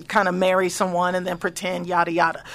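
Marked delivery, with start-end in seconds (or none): none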